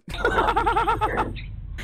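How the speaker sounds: high-pitched laugh; laughter at his own mockery. Real, not acted. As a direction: burst into high giggling. Laughs at his mocking impression.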